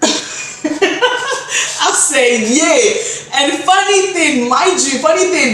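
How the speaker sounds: high-pitched, giggly telling; cracking up at the memory; laughing. Real, not acted. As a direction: talk through your own laughter. Laughing while retelling embarrassment.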